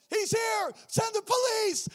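high, loud call for help; frantic scream. Retold or imitated. Wife shouting into phone for police, frantic. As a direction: yell into the phone in panic. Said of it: "She's screaming".

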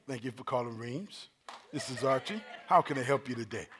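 soft, low customer-service voice; smooth, hushed professional phone greeting. Shown as a demonstration. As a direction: answer the call as a polite service rep. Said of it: "I put my phone voice on".

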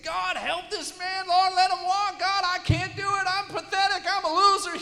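hammed-up plea, high and very loud; desperate self-loathing prayer. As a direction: cry out to God in mock despair. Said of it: "feeling terrible about myself".

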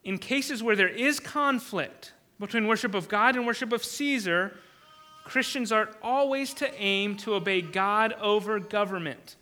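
ordinary talk

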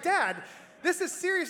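pleading with dad to take it seriously; exasperated plea. Retold or imitated. Pleading with his laughing father.

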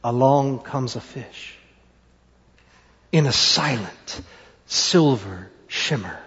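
low, soft, slow reveal; hushed storytelling. A narrator's reading.

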